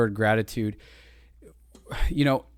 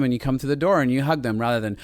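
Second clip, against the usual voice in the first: usual speaking voice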